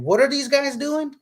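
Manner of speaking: high, amazed disbelief; incredulous. Retold or imitated. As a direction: ask it in total disbelief. Disbelief at friends cheering.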